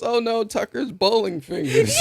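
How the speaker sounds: high-pitched, hammed-up dismay; squealed mock horror. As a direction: imitate a horrified discovery in a high voice. Retold cry of comic dismay.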